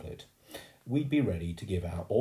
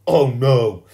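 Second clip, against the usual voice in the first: very loud, higher shout of refusal; angry shout; 5 semitones higher, 16 dB louder than usual. A narrator's reading. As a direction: shout your refusal at full volume. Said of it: "Jimmy shouted".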